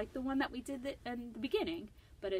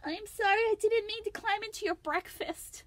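put-on high girlish voice, sheepish apology; a high little sorry from Lucy; Lucy apologizing; 12 semitones higher, 5 dB louder than usual.

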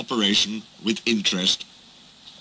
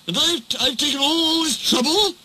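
high, indignant near-shriek; almost shrieking; 14 semitones higher than usual. A narrator's reading. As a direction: cry out in disbelief. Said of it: "he almost shrieked".

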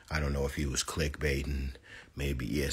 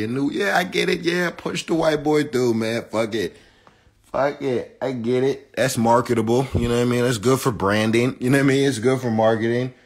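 higher, louder sneering voice; mock the league's marketing talk; sarcastic mockery; 7 semitones higher, 7 dB louder than usual.

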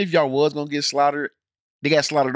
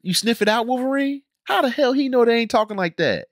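high, disbelieving outburst; how could he possibly know; mock disbelief, pitched way up; incredulous; 11 semitones higher than usual. Real, not acted. Incredulous at Wolverine knowing.

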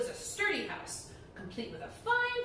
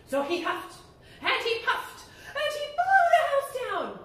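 building excitement; 10 semitones higher, 7 dB louder than usual. A narrator's reading. Narrating wolf blowing.

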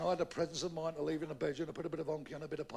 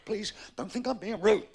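high, loud exasperated plea; raised-voice pleading; 5 semitones higher, 6 dB louder than usual. Acted. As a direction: plead with a pupil, voice pushed up and loud. Exasperated golf pro pleads.

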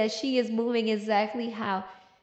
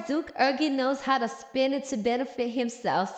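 plain reading voice

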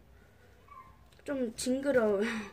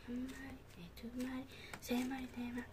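whispering; 3 semitones higher, 10 dB quieter than usual. Real, not acted. Counting in a whisper.

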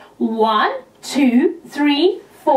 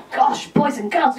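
normal talking voice

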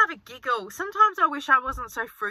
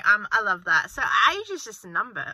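normal talking voice

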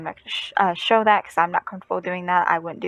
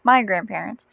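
ordinary delivery

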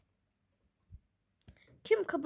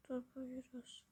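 usual speaking voice

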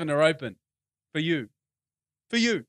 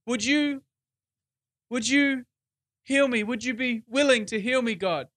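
higher-pitched, halting imitation of a supplicant; hesitant plea; 3 semitones higher than usual. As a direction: imitate someone timidly asking for a favour. Voicing a hesitant plea to Jesus.

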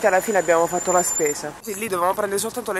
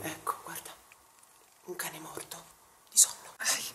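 whispering; 12 dB quieter than usual. Real, not acted. Dog is sleeping nearby.

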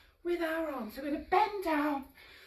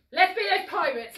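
ordinary talk

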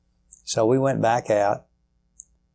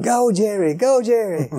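imitated holler; 11 semitones higher, 4 dB louder than usual. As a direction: holler like an excited fan, pitched way up. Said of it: "he's hollering".

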